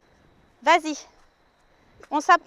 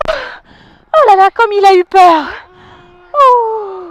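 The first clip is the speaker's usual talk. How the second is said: loud, slow shaken exclamation; shaken relief; 4 semitones higher, 10 dB louder, slower than usual. Acted. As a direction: let the fright out loudly. Recovering from fright, big exhale.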